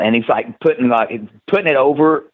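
ordinary talk

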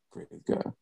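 soft whispered aside. Real, not acted. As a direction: breathe it quietly. Quiet aside.

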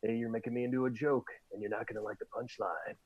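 low, hushed action-hero growl; understated movie tough guy threat. Retold or imitated. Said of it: "The Rock in a movie being like".

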